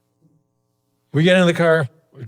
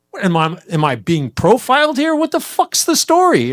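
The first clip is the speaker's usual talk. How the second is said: indignant outburst; 12 semitones higher, 13 dB louder than usual. Retold or imitated. Indignant retort in retold scene.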